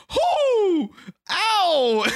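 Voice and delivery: high, squeaky yelp of pain; retold pained cry. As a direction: cry out like the swab just hurt. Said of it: "and I'm like".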